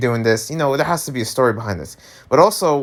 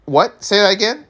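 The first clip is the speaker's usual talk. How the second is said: challenging; 8 semitones higher than usual. Retold or imitated. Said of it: "I'm like".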